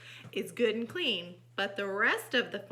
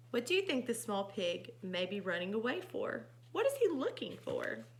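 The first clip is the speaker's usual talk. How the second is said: ordinary delivery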